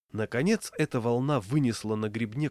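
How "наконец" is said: ordinary delivery, nothing marked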